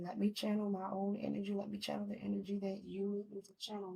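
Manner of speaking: soft murmured prayer to the Lord; hushed prayer. Real, not acted. Tarot reader praying softly to cleanse her own energy. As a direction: pray under the breath to clear her energy.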